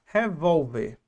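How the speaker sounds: revólver said slowly, VÓL stressed; stress on penultimate syllable. Shown as a demonstration. Teacher showing the tonic syllable, revólver stressed on VÓL. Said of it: "A penúltima".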